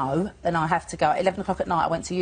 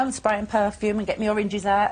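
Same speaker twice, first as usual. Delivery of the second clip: usual speaking voice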